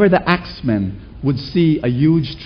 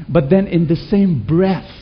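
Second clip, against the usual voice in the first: normal talking voice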